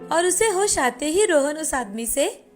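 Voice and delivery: ordinary delivery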